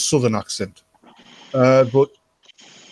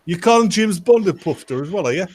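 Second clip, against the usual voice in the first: higher, pressing question in mock offence; affronted on purpose, pitch raised; mock indignant challenge; 6 semitones higher than usual. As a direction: press the playful accusation. Mock challenge repeated to friend.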